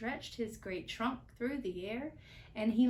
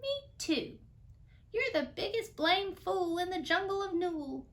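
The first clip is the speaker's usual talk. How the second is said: high, louder little joey voice; young kangaroo; 9 semitones higher, 4 dB louder than usual. A narrator's reading. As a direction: chime in like a cheeky joey. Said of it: "the young kangaroo in her pouch said".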